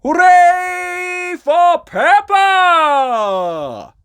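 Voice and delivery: high, drawn-out cheering shout; crowd cheer. A narrator's reading. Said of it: "they cheered and cheered".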